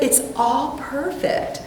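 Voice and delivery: calm reassurance. Retold or imitated. Friend's reassurance retold.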